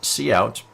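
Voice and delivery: cout said slowly as "see-out"; name the letter C in cout, then say out; cout pronounced see-out. Shown as a demonstration. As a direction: give cout two syllables, "SEE-OUT". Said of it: "it's pronounced cout".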